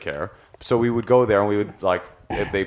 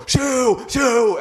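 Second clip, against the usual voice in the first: very high, hammed-up yelped shoos; mock screaming; 3 dB louder than usual. Raging boyfriend shooing the dog owner away, mock screaming. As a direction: imitate a man shrieking at someone. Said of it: "he would go".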